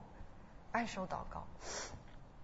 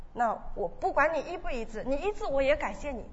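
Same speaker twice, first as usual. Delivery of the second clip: raised, pleading prayer voice; fervent prayer; 5 semitones higher, 5 dB louder than usual. Retold or imitated. Retelling her vow to God, a raised fervent prayer. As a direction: pray out with growing force.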